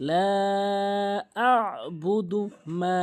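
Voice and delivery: slow "লা আ'বুদু মা" with long vowels; model recitation. Shown as a demonstration. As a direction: hold "লা" long and press the ayn. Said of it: "এক আলিফ টেনে পড়তে".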